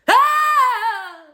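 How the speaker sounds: high, loud, held scream; sudden goofy shriek held long; silly sudden scream. Shown as a demonstration. Teacher breaking a serious classroom moment with a silly scream. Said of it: "I'll just be like".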